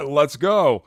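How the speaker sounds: light imitation of Kurt's fired-up let's go. Host echoes co-host Kurt with a mild fired-up cheer. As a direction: hype it up the way Kurt does, half laughing. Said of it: "sound like Kurt right there".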